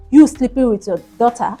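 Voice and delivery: ordinary delivery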